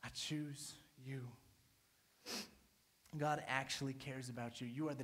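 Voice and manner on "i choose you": soft, slow, tender promise; hushed, loving reassurance; tender God voice. Acted. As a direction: speak gently as God choosing them. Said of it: "and he still says".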